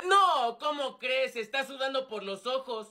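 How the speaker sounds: usual speaking voice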